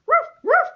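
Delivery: high loud woof woof; playful dog bark. Shown as a demonstration. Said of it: "Doggies are more like".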